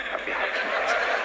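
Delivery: Rabbi habli minas solihin breathed out with no voice; whispered prayer. Pretend. Father whispering the prayer over his child, whisper. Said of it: "Jamin kalau bapaknya".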